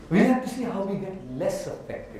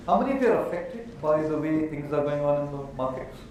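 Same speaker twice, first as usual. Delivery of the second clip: usual speaking voice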